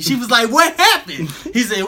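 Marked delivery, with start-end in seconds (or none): 0.5-1.3 high, loud retold question; mock alarm. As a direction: act out her startled question, pitched way up. Retelling woman's alarmed reply.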